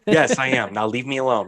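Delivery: ordinary talk, nothing marked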